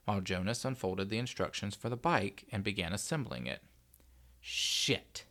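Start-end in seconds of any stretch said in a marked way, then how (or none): 4.4-5.2 low, voiceless swear to oneself; muttered curse. A narrator's reading. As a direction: curse under the breath. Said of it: "cursed under his breath".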